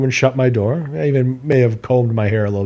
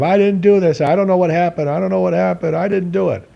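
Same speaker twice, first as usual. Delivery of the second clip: high, mild mock yell; retold screaming back; 7 semitones higher than usual. Son screaming back at his accusing dad, mild mock yell. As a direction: protest in a raised pitch, no real volume. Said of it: "I screaming back at him".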